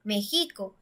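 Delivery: México mis-stressed as "mexíco", weight on "xi"; stress on middle syllable. Shown as a demonstration. Teacher trying México with the second-to-last syllable stressed, "mexíco". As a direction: lean on the "xi" in México. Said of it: "Nosotros tampoco decimos".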